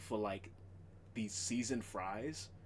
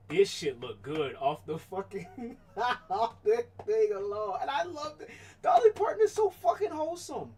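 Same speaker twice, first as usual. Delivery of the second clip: high, loud gushing over the icing; thrilled fan gushing, much higher and louder; gushing excitement; 9 semitones higher, 10 dB louder than usual. Real, not acted. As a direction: squeal with delight over it. Gushing over [name] icing.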